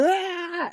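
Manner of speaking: high mock straining yell; imitated lifter's scream. Lifter doing his last rep, high mock scream. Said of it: "he's like".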